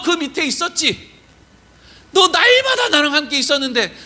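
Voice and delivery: high, impassioned pleading; earnest reproach. Acted. God reminding Joshua of their years together, high impassioned reproach. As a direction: press each reminder home insistently. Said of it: "이러시는 것 같은 거예요".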